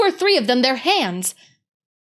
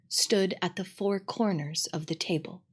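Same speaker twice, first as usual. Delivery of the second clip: usual speaking voice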